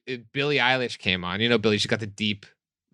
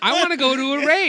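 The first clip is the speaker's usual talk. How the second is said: high whiny mock plea; 13 semitones higher, 5 dB louder than usual. [name] whining to friends about missing raves, high whine. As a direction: whine it like a jealous kid.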